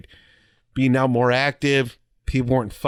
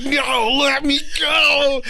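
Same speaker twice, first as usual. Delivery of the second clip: slow, high frantic yell; struggling to break free; panicked shout; 11 semitones higher, much slower than usual. Retold or imitated. Recruit grabbed, struggling.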